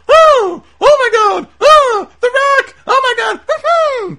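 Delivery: shrieking falsetto, loud and very high; the isolated commentary track, imitated as a piercing high squeal; Jerry Lawler squeal. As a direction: squeal like an overexcited Doughboy. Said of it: "All you would get is".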